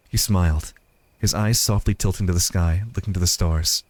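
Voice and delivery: low, even storytelling voice; story narration. A narrator's reading.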